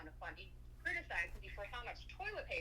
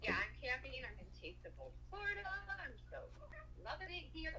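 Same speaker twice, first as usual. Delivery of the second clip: much higher, delighted; thrilled to spot a butterfly; bright happy squeal of delight; excited; 6 semitones higher than usual. Real, not acted.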